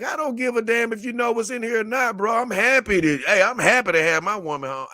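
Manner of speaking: high, loud, excited retelling of what he told the delivery guy; excited retort. Retold or imitated. To the delivery guy, happy his doll arrived, excitedly. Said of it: "'Cause I'm like".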